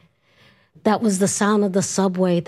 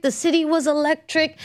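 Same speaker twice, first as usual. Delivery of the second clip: ordinary delivery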